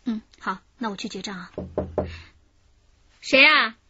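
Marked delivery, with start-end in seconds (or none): 3.2-3.8 sharp loud call toward the door. Acted. Woman at hotel room calls out to the knock, loudly.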